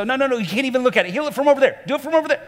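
high-pitched, rushed mock protest; protesting hurt kid. Hurt child keeping the helper at a distance, mock panicked protest. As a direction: keep them away in a high, hurried voice.

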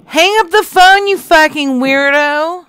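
furious outburst at the caller; angry shout. Real, not acted. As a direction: yell it high and loud in disgust. Insulted caller yells at him.

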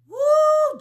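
ecstatic high woo; preacher's joyful shout; high whoop. Real, not acted. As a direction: let out a loud high whoop.

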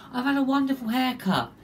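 ordinary talk

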